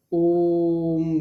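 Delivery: ओम stretched very long on the vowel; prolonged pluta ओम; drawn-out Om. Shown as a demonstration. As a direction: hold the ओ of ओम for three counts. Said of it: "ये देखिए यहाँ पे हमने तीन जैसा बनाया है".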